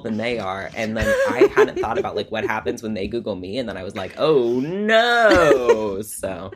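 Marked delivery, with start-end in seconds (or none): 4.2-6.0 high, drawn-out comic "oh no"; hammed-up dawning dread; mock dismay. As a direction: wail it in exaggerated horror. Retold dread at being Googled.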